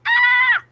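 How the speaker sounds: high, loud mock scream. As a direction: let out a hammed-up muscle scream.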